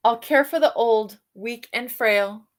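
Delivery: ordinary delivery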